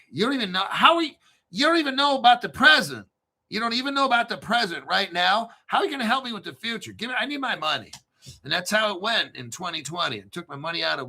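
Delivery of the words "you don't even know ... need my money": high, insistent retold argument; exasperated demand. Customer demands his money back from advisor, exasperated high-pitched retelling. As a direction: press the point, pitched up and indignant.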